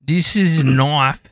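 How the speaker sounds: slow, drawn-out mock Aussie accent; slow Australian. Streamer repeating the knife line to friend, slow Australian. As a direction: stretch the Australian catchphrase out slowly. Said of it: "you sound like a slow Australian".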